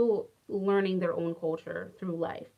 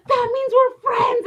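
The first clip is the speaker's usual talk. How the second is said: put-on very high, loud, slow child voice announcing it; boy declaring friendship after his finger game; excited little boy; 14 semitones higher, 9 dB louder, slower than usual. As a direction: squeal it like a thrilled little kid.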